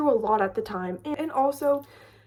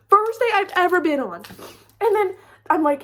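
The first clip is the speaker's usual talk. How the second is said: excited; 6 semitones higher, 6 dB louder than usual. Real, not acted. Excited retelling of panic.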